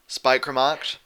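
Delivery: ordinary delivery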